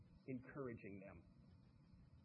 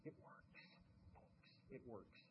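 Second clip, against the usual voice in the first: whispered aside, no voice at all; 2 semitones lower, 16 dB quieter, slower than usual. Real, not acted. Speaker telling the folks delegation works, in a whisper. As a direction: lean in and whisper it.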